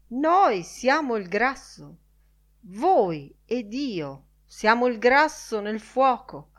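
declaiming Crawford. Acted. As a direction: proclaim it like a forceful orator.

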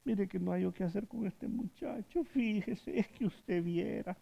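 soft, pleading mother's lament; a hint of a tearful old mother; tearful plea. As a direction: beg for help like a worried crying mother. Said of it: "Anda llorando en la calle".

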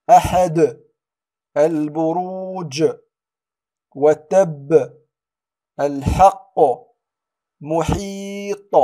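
"أحد، البروج، وتب، الحق، محيط" slow, each final consonant strongly bounced; قلقلة كبرى. Shown as a demonstration. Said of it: "هنا القلقلة الكبرى". (قلقلة قوية لآخر "أحد، البروج، وتب، الحق، محيط")

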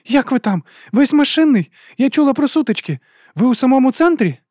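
very high, loud, anxious woman; urgent Juliette. Acted. Juliette asking Walker about the fighting, high and urgent. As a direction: fire anxious questions in a high voice.

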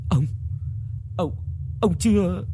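stammering, voice lifting high on the last word; trembling question. A narrator's reading. Wife stammers at her husband risen from the dead, trembling. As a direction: falter through it, too shaken to finish. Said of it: "bà Tam run run hỏi".

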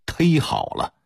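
忒好了 with the dialect tēi for 忒; Beijing local pronunciation. Shown as a demonstration. Lecturer gives a dialect example, 忒好了 said with tēi. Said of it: "虽然也是北京土音儿".

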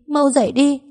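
the plea grows into a wail. Acted. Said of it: "Tôi lớn tiếng gào khóc". (gào khóc, giọng vút cao)

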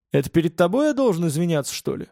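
plain reading voice

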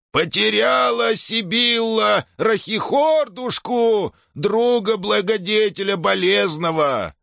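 very high, slow whining wail; puppyish wail. A narrator's reading. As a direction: howl it like a little puppy. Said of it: "взвыл он щенячьим голоском".

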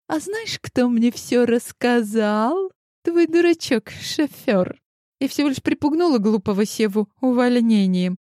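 high, gloating shrill mockery; gloating taunt. A narrator's reading. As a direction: relish every cruel word. Fury exposes the heroine.

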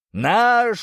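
loud, high drawn-out giant's roar; крик великана. A narrator's reading. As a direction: bellow it out like a giant. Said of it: "закричал он, разрывая своим басом". (громкий крик великана)

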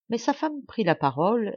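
ordinary delivery, nothing marked

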